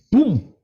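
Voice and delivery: loud, high explosive "boom"; imitated boom. Preacher describing power leaving his body, loud imitated blast. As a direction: burst it out like a sudden blast.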